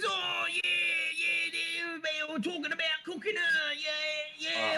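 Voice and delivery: high, whiny Cockney rat in a flap; squeaky Cockney rat voice; panicky Cockney rat. Acted. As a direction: wail like a fretting street rat. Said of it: "He says".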